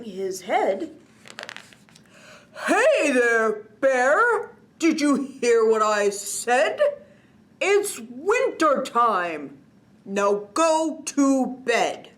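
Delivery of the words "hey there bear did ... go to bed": louder, slow scolding yell. A narrator's reading. Old Man Winter to Big Bear, scolding yell. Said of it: "Old Man yelled".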